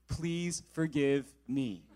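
very slow, low "Please forgive me"; slow solemn plea. Retold or imitated. Groomsmen showing another version, slow and earnest.

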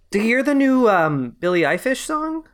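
high-pitched clueless Minnesota mom. Pretend. Mom asking her kid about a celebrity, put-on mom voice. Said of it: "sound like a mom talking to their kid".

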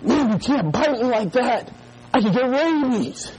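high, drawn-out mock complaint; mock alarm. Boy to his brother in a game, mock alarm. Acting out childhood prank, pretend bite.

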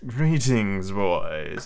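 lightly put-on heroic greeting; hailing cry. A narrator's reading. Peter dropping in before the boys, lightly hailing them. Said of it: "he cried".

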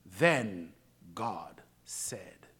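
whispered, low and slow; preacher whispering to build suspense; hushed whisper. Acted. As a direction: draw them in with a hush. Hushed scripture reading.

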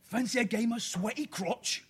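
a pub quizmaster in put-on Cockney; hammed-up Cockney accent. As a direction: lay on a thick Cockney accent, slowly. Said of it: "do it in a Cockney accent".

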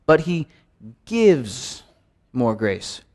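quiet meditative reading broken by pauses; slow, pausing read. Shown as a demonstration. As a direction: lay each piece down slowly, with gaps between them. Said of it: "each time emphasize a different word".

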